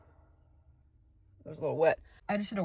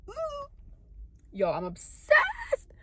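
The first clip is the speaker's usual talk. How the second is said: much higher, slow gushing; excited squeal; much slower than usual. Real, not acted. Admiring her shimmering lips, squealing. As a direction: swoon over the look.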